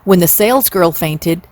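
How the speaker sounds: ordinary delivery, nothing marked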